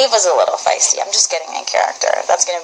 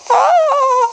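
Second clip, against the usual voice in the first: high, sustained mock-frightened squeal; high-pitched cry of pretend fright; scared squeal; 4 dB louder than usual. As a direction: let out a long, playful little scream. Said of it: "I'm scared".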